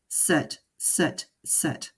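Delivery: plain reading voice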